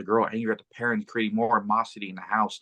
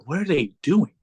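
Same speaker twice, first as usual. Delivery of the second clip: higher, alarmed question; acted bewilderment; 7 semitones higher, 4 dB louder than usual. Demon watching the people take its minion, baffled.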